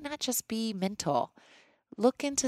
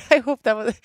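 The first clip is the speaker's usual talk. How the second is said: real laughter breaking through the words, louder and higher; loud, laughing self-mockery; 6 semitones higher, 10 dB louder than usual. Real, not acted. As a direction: laugh out loud at your own attempt. Laughs at own attempted accent.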